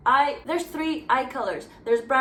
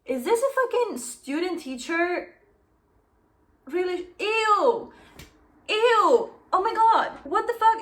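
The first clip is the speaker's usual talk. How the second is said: high, loud, revolted outburst; disgusted outrage; 8 semitones higher, 6 dB louder than usual. Real, not acted. Reader discovering a student-teacher romance, appalled. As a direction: recoil in loud, horrified disgust.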